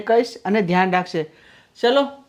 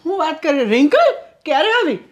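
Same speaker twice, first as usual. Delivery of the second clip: delighted surprise; 6 semitones higher than usual. Acted. Husband delighted guest arrived.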